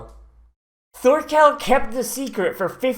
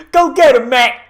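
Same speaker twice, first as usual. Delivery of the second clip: loud excited cheer; cheering shout; 6 semitones higher, 8 dB louder than usual. Real, not acted. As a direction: yell encouragement at the fighter. Cheering on a fighter.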